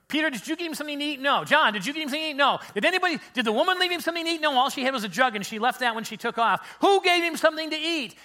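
high, loud, rapid-fire questioning; baffled interrogation. Acted. Disciples asking each other who fed Jesus, baffled.

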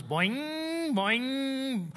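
drawn-out springy boings, pitch swooping up; bouncing boing noise. Retold or imitated. Preacher mimicking a plane bouncing on touchdown, springy boings.

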